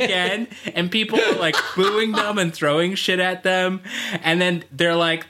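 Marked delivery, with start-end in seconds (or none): none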